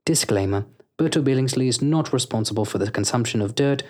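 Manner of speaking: smooth commercial announcer voice; hammed-up radio ad read; fake ad read. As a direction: sell the bakery like a cheesy radio spot.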